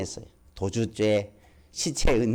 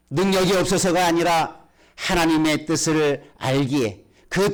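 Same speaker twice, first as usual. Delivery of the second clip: fervent preaching; 6 semitones higher than usual. Acted. Preacher telling congregation of Jesus praying before suffering, fervent preaching. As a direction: preach it higher, pressing each phrase.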